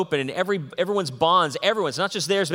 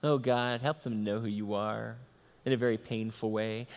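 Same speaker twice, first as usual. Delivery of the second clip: mock pious prayer; 5 semitones lower, 7 dB quieter than usual. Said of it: "I would be just so spiritual".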